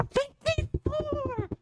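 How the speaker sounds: very high, slow squeal of disbelief; stretched high-pitched amazement; awed squeal. Real, not acted. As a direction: squeak the number in awe. Awed at the move count.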